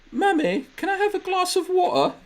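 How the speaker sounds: put-on very high little British boy voice; imitating a British child. Imitating cousin's son to crack up, squeaky British child voice. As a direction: mimic a small English kid asking politely. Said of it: "I kept imitating him".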